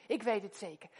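normal talking voice

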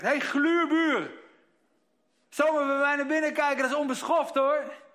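loud, high, furious scolding; angry shout. Acted. Old woman yelling at her reflection, furious. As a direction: yell at the stranger in the window. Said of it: "Ze begint te schreeuwen".

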